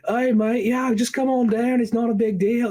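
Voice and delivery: high, easygoing Aussie drawl; laid-back Australian dude voice. Acted. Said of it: "like, Aussie dudes possible".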